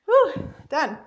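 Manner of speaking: loud relieved whew; relieved exhale. Real, not acted. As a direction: breathe out with relief. End of hard round.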